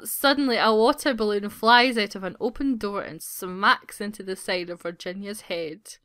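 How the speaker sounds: normal talking voice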